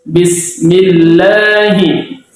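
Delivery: Bismillahi pelan, ba diucapkan jelas. Shown as a demonstration. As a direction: lafalkan Bismillahi dengan ba tegas. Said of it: "baca dengan jelas". (contoh bacaan ba yang jelas)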